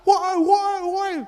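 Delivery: loud high yell of woi, woi; shouting for help at the shore; retold shout. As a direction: call out woi as if to guards far off. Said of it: "Kita teriak".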